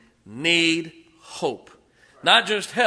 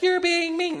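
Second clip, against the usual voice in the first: high-pitched mock-upset mom; upset mommy; 4 dB louder than usual. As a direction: scold in an imitated mother's voice. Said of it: "And mommy gets upset".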